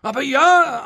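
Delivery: high, loud, stricken confirmation; dismayed. A narrator's reading. Innkeeper learning his old guest is dead, dismayed. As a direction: answer with a shaken outburst. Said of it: "antwortete Tebaldo betroffen".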